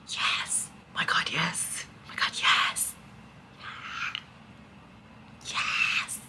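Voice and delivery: ecstatic hushed whisper; whispered yes. Real, not acted. Reader whispers delight to viewers over the mates. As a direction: whisper your joy.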